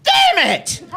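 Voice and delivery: high, loud mock outraged "God damn it"; Mama Jean's scornful curse. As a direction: screech the curse like an appalled mother. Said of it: "the object of her scorn bracing for the damn it".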